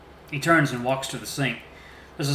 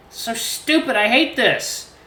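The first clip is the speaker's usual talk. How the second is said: frustrated outburst; 10 semitones higher, 5 dB louder, much slower than usual. A narrator's reading.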